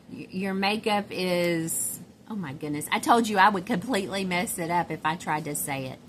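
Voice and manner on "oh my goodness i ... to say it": normal talking voice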